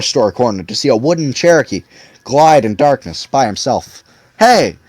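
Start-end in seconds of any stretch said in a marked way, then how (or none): 4.4-4.8 sudden call. A narrator's reading. Proprietor startles the boys.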